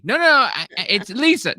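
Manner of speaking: high, loud insistent correction; flustered relative. Retold or imitated. Relative corrects mixup on the phone, high flustered voice. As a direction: insist on who you are. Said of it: "And they go".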